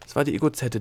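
ordinary delivery, nothing marked

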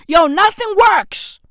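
high, loud outburst; exasperated shout. Real, not acted. Podcaster ranting about South Africa, exasperated shout.

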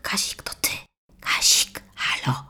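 secret phone whisper; whispering so mother won't hear. Acted. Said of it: "Mówię cicho, żeby mamusia nie słyszała".

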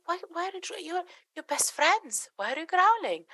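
high, mock-hurt parent voice; upset parent. Parent scolding the family dog, in a high mock-hurt voice.

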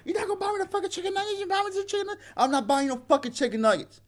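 high-pitched imitation of an irate woman; mock cussing out. Friend imitating the woman cussing him out, high mock complaint. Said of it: "She started cussing me out".

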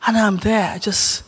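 ordinary talk, nothing marked

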